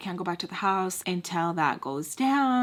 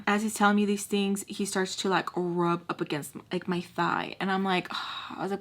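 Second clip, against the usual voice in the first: ordinary delivery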